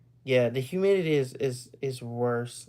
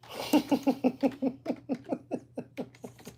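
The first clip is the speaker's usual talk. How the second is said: high giggle; 11 semitones higher, 4 dB quieter than usual. Real, not acted. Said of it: "Oh, Jesus Christ".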